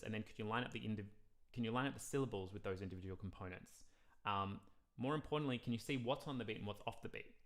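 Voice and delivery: ordinary talk, nothing marked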